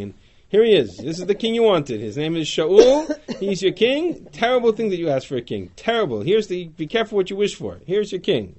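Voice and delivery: loud, emphatic presenting the king; booming prophet presenting a king; acting out Shmuel. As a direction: proclaim it like a prophet to the crowd. Teacher acts Shmuel presenting king.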